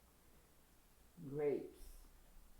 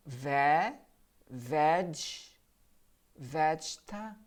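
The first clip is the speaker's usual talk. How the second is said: broken into syllables; much slower than usual. Shown as a demonstration. Teacher breaking a word into parts for students. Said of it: "Here, I'm going to stop".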